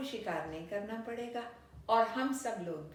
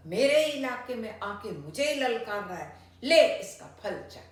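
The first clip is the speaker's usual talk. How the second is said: much louder, building to a huge roar; furious roar; 4 semitones higher, 10 dB louder than usual. A narrator's reading. Lion roaring at the rival in the well before jumping, furious. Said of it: "गुस्से में दहाड़ता हुआ शेर बोला".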